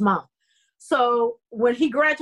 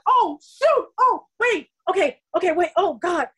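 high, flustered, panicky gasps; replaying her shock at a ten-page audition due tomorrow; freaking out; 6 semitones higher than usual. Actress hearing of a surprise audition, high panicky flustered voice. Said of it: "So I'm freaking out".

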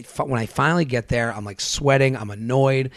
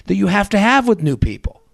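ranting retort; 6 semitones higher than usual. Retold or imitated. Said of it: "I go".

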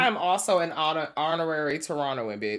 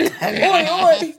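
high, loud, laughing British "oi"; hammed-up Cockney British shout; mock British accent; 11 semitones higher, 8 dB louder than usual. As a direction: belt out a cartoon British oi through laughter. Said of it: "practicing my U K accent".

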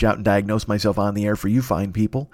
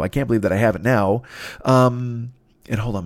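ordinary talk, nothing marked

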